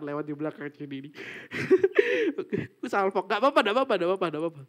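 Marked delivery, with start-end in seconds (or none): 1.2-2.4 a sudden high-pitched burst of laughter; startled high laugh. Real, not acted. Spooked by something behind, a high startled laugh. 3.2-4.6 flustered reassurance. Real, not acted. Reassuring after distraction.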